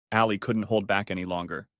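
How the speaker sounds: plain reading voice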